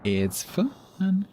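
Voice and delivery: sing-song high "It's fun"; exaggerated high falling "fun"; mock high. Shown as a demonstration. Repeated in a higher voice.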